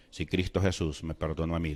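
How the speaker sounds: low, very soft quoted words; retold softly, as words barely let out; hushed forgiveness. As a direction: drop right down to a subdued, low voice. Retelling his words forgiving mother's killer.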